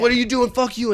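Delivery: high-pitched imitated biker challenge; retold indignant outburst, pitched well up; mock confrontation. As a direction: challenge him like a riled-up biker, hammed up.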